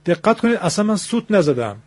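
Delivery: usual speaking voice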